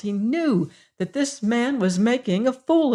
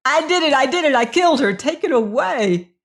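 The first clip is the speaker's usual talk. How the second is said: high, louder panicked shrieks; terrified confession; 8 semitones higher, 6 dB louder than usual. A narrator's reading. Prisoner confessing to the warden, screaming in panic. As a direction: blurt it out in pure terror.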